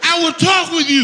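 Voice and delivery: shouted high, leading the crowd; loud call-and-response. Shown as a demonstration. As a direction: call it out big for them to echo. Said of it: "repeat after me and make it loud".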